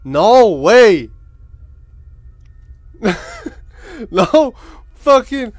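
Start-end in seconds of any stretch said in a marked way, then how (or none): 1.2-4.1 breathless laugh. Real, not acted. Friend caught, bursts laughing.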